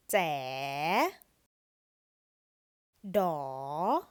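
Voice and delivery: scoop each syllable down and then up; Thai rising tone. Shown as a demonstration. Teacher drilling syllables with the last tone mark, rising tone. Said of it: "the last tone mark makes a rising tone".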